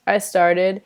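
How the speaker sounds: ordinary delivery, nothing marked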